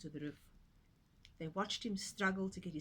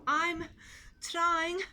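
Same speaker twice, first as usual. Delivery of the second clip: high, loud breathless piglet; panting; 9 semitones higher, 7 dB louder than usual. A narrator's reading. Little Pig panting while dragging wings up the roof. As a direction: gasp it out mid-climb. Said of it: "panted Little Pig".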